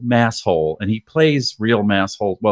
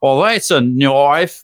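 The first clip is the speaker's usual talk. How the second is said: louder, very slow hammed-up Australian accent; 3 semitones higher, 4 dB louder, much slower than usual. Shown as a demonstration. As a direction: lean hard into the Australian accent, "Well, that's a knife.". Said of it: "I just wanted to do my Australian accent".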